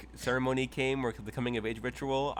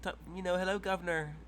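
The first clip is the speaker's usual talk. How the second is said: higher hammed-up British voice; mock British accent; 8 semitones higher, slower than usual. Player voicing Knight in a higher mock British accent. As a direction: play a silly posh Brit. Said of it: "me fellow, uh, Brits".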